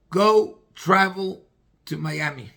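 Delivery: slow, weighty and loud on go travel; solemn pronouncement. Retold or imitated. Rebbe tells the broken man, slow and weighty. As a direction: hand down a verdict, slowly. Rebbe gives his one-line answer.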